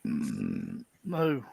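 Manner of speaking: very low, soft humming moo; cow moo. Pretend. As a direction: hum into a quiet low moo. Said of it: "say moo".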